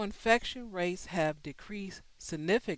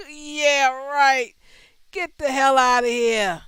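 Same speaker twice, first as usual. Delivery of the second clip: high, loud, dismissive disbelief; loud scoffing; 6 semitones higher, 11 dB louder than usual. Real, not acted. Host dismissing the detection program, scoffing loudly. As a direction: throw the claim out, loud and scornful. Scoffs at the claim just read.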